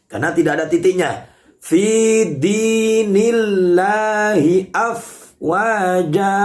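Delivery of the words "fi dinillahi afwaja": "afwaja" ending held for a single beat; one-beat ending. Shown as a demonstration. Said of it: "dibaca di satu ayunan". ("afwaja" dibaca satu ayunan)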